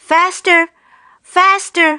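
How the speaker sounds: frantic high urging shout. A narrator's reading. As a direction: drive the men on, frantically.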